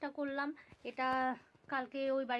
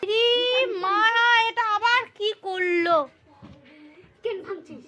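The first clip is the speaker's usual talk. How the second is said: exasperated exclamation; 8 semitones higher, 16 dB louder than usual. Real, not acted. Child breaking things.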